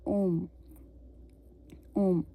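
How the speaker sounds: ओम with no h sound at the start; French homme as ओम, no h; silent H. Shown as a demonstration. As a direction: say ओम, dropping the h entirely. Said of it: "H हम नहीं pronounce करेंगे".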